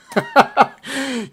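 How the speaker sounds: loud high laugh bursting out; big burst of laughter. Real, not acted. Host cracking up at the demon's words, loud laugh. Host reacts to scripture cross-reference.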